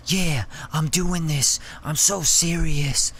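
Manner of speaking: slow, lightly whispery mock seriousness; mock whispery artist. Mocking artists who do the whispery thing, slow. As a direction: imitate a rapper trying too hard. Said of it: "you don't need to do the whispery thing".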